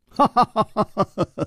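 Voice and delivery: high, quick string of ha-has; amused laugh. A narrator's reading. Hero laughs at his attacker, high quick laugh. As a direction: laugh freely in the face of danger. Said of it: "反而笑着说".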